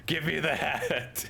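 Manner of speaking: slow, high greedy creature; hammed-up demand. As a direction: stretch it out like a greedy critter. Acting out creature clawing face.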